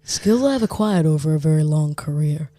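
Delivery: lightly voiced, deliberate film threat; deaf Liam Neeson. Warning a kidnapper about his skills, mock-menacing. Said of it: "Sounds like a Death Liam Neeson".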